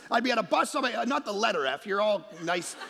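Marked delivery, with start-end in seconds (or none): none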